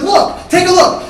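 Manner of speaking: high, loud, slow demand; drawn-out angry shout; exasperated yell. Acted. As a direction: shout it slowly to make him see. Yelling at brother, holding up cat.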